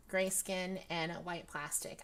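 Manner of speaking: ordinary delivery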